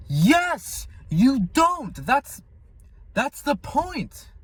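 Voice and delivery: very high, insistent exclamation; emphatic retort. Real, not acted. Answering frustrated Christians, high emphatic retort. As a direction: drive the point home in a soaring pitch.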